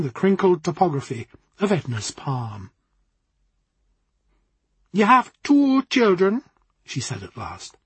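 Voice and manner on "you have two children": slow, higher Jamaican fortune-teller; Jamaican accent. Acted. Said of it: "in a rich Jamaican accent".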